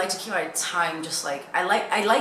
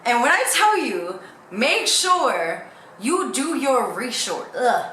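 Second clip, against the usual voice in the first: normal talking voice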